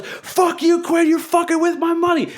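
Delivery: high-pitched mock scream of rage; retold screaming. Enraged street performer attacking a co-worker over money, screaming. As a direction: screech it like someone losing it completely. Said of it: "starts screaming at me".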